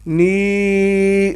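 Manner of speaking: نی held long as neeee. Shown as a demonstration. Teacher models the long vowel. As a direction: draw نی out evenly. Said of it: "نون زیر یا".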